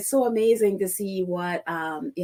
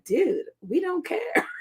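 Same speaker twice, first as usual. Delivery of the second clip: drawn-out, higher "Dude, we don't care"; dismissive "dude"; 8 semitones higher, 4 dB quieter, slower than usual. Retold or imitated. To the offended Tory MP, a drawn-out dismissive brush-off. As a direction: slowly wave off the offended MP. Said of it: "And it's just like".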